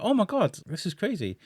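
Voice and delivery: high, excited gasp of disbelief; mock excited fan squeal; starstruck squeal. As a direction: squeal like a fan seeing a star. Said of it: "I'm like".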